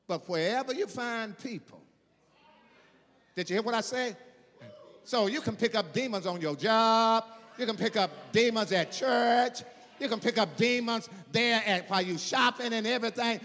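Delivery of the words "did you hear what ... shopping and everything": forceful pulpit cadence, higher and louder; preaching at full force. Real, not acted. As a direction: drive each phrase home to the congregation.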